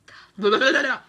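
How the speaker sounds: a short, loud burst of frustrated laughter; an angry laugh breaking through the talk; exasperated laugh. Real, not acted. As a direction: laugh it out in real annoyance. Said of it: "sorry, I'm mad".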